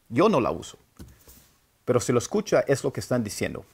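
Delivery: ordinary talk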